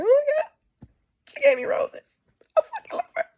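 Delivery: very high, loud weepy squeal; hammed-up breaking down in tears, high and loud; mock crying. Telling how a friend gave roses, mock tearful. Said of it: "almost broke down and started crying".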